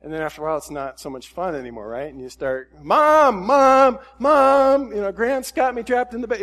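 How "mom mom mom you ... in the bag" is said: put-on high, loud kid shouting for Mom; kid yelling for Mom. Child trapped in the bag by his big brother, yelling for Mom. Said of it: "you're yelling".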